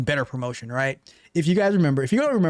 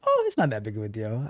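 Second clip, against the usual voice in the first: mock nonchalance; 6 semitones lower, 4 dB quieter than usual. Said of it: "was he pouting or was he".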